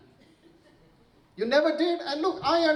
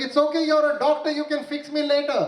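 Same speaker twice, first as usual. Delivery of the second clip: cheeky louder retort; 6 semitones higher, 4 dB louder than usual. Retold or imitated. Cheeky retort to doctor mother-in-law.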